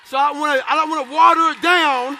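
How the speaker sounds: the speaker shouts to the crowd about not watering down his story, laughing loud; fired-up shout. Real, not acted. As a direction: belt it out to the crowd.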